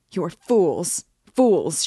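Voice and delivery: loud, contemptuous cry at the room; crying fools. Acted. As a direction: spit it at all of them. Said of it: "she cried, addressing the whole room".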